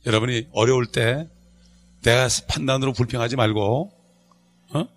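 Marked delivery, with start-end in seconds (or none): none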